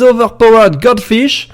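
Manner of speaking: put-on team name in a drawn-out English accent; slow English-accented announcement. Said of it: "mon accent anglais".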